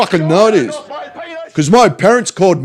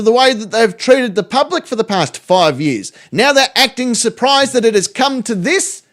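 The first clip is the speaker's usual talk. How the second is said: higher-pitched put-on poster's voice; mocking read-aloud; 5 semitones higher than usual. Streamer reading out a reposted rant, in a higher mocking voice.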